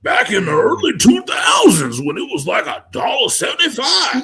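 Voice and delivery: a hint of a grumbling old-timer; very old man. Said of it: "let me put on my old man voice".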